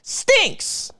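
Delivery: high, rising mock-outraged whine; squeaky, exaggerated mockery of the commenter; mocking whiny commenter. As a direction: parody the troll's complaint. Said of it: "in all caps".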